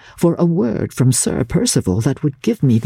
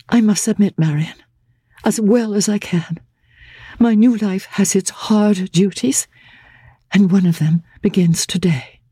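a bride bracing for hard duty; raised, steady voice of sad submission; Laura resigned; 7 semitones higher than usual. Acted.

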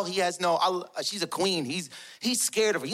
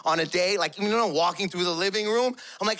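ordinary delivery

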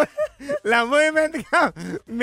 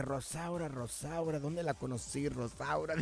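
soft puzzled mutter, much softer; muttering to himself; 6 semitones lower, 15 dB quieter, slower than usual. Retold or imitated. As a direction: mumble the name, trying to remember. Thinking aloud, trying to remember.

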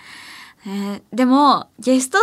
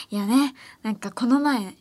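ordinary talk, nothing marked

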